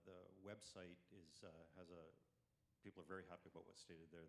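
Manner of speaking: ordinary delivery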